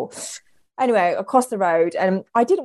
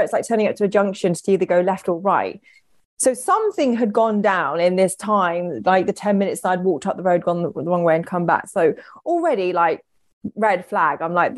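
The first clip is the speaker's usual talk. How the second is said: usual speaking voice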